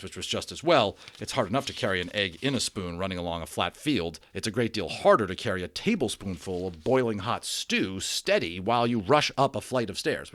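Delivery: ordinary delivery, nothing marked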